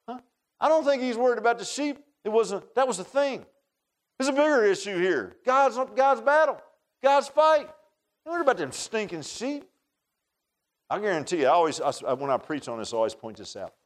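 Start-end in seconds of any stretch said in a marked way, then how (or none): none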